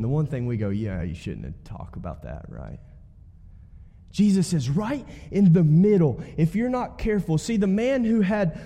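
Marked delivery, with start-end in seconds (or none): 0.7-2.8 low, soft mocking aside; mock mutter. Pastor mimicking a dismissive listener, low mock mutter. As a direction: mumble it like a dismissive churchgoer.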